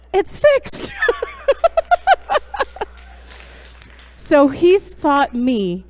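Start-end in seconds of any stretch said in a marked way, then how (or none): 0.1-0.9 much higher and louder, gleeful; proud triumphant announcement. Retold or imitated. Pastor to the guilty teen driver, a gleeful high triumphant call. 1.0-4.1 high, loud breathy burst of laughter; big delighted laugh. Real, not acted. Speaker to the congregation after the story, a high delighted laugh.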